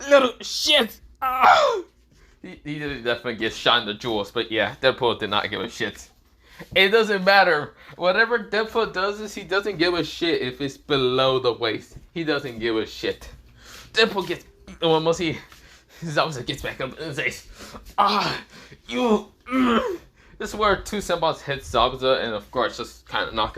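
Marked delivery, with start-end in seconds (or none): 0.1-1.8 loud, high cursing cry; pained rage. Acted. Zabuza, shot, rages and screams. 18.0-20.0 high, strained groaning curse; pained anger. Acted. Injured Zabuza struggles up cursing.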